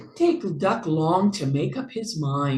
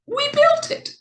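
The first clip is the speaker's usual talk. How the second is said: much higher, tiny mouse squeak; squeaky mouse; 5 dB louder than usual. A narrator's reading. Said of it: "squeaked Mouse".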